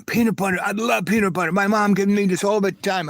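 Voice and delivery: high, gushing imitation of a friend; delighted. Voices delighted new friend.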